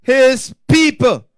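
loud, high, emphatic sermon delivery; forceful preaching. Real, not acted. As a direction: hammer the story home to the congregation.

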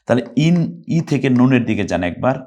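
normal talking voice

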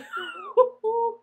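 a sudden high giggle; high burst of laughter. Real, not acted. Singer laughing at her own story, high giggle.

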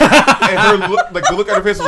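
high and loud, laughing through the words; can barely get it out for laughing. Real, not acted. Laughing while retelling.

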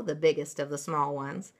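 plain reading voice

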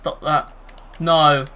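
usual speaking voice

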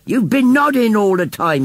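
high-pitched, emphatic accusation; insistent postman. A narrator's reading. Postman argues with Missus Kibbins over the bid, insistent. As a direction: set her straight firmly.

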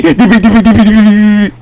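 rapid high-pitched mock babble; screaming kid imitation. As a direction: imitate a kid's screaming as quick nonsense syllables. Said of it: "I just hear the kid screaming".

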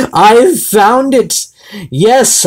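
slow, high, loud triumphant gloat; mad scientist. Acted. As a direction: crow over the find like a mad genius. Gloating over a found card.

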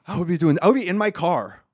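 usual speaking voice

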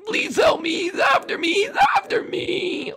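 very high, loud robot squeal; panicking robot. Pretend. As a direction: cry for help in a put-on robot voice. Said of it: "you dumb robot".

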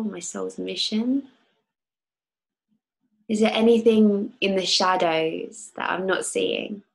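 calm guide leading a visualization; guided meditation. Acted. Leads a guided oil meditation.

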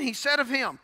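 normal talking voice

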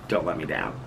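laughing at her own plea; laughing softly. Real, not acted. Repeats it, laughing.

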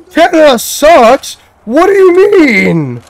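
high, slow put-on voice of outrage; mock offended. Gamer answering the game's insult, in a high mock-offended voice. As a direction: play the scandalised Canadian.

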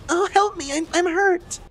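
very high, whimpering mock plea; hammed-up helpless falsetto cry for help; mock hurt Isaac. Player mocks Isaac tapped by a monster, high feeble whimper. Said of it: "gets tapped by a monster and goes".

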